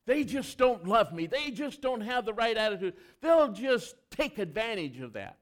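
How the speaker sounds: whining spouse. Retold or imitated. Pastor mimics a spouse who fears being used, loud mock whine. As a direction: whine it like a put-upon spouse. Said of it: "hear one of the spouses say".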